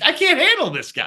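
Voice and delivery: lightly voiced beaten defender; mock overwhelmed defender. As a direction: play a defender who has given up. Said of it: "He'd be like".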